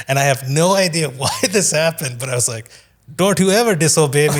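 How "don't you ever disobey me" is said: stern, mock Indian accent; commanding Indian-accented dad voice. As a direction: scold in an exaggerated Indian accent. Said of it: "in an Indian accent".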